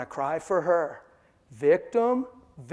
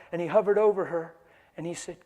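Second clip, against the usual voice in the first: ordinary delivery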